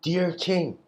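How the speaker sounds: high, pompous "Dear king"; grand address. Shown as a demonstration. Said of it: "he doesn't say it like that".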